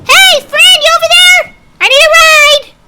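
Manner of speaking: loud, high call across the yard. Acted. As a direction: shout it out to a distant friend. Calling out to a friend.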